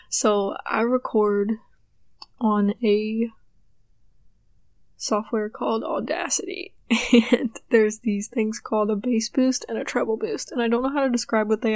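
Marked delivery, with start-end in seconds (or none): none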